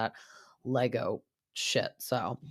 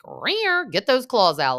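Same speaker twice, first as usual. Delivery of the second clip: high cat yowl into playful goading; playful yowl, egging on a fight; mock catfight; 9 semitones higher, 6 dB louder, slower than usual. Host cheering Lala into the fight, mock catty yowl.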